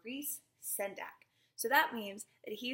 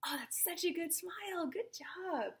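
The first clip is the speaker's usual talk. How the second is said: plain reading voice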